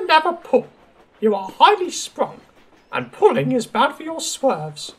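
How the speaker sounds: high-pitched, self-important explaining; prim lady diesel. Acted. Daisy tells staff her fitter forbids pulling, self-important. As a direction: explain loftily in a high, precious voice.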